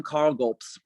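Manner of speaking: normal talking voice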